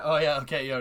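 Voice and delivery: ordinary talk, nothing marked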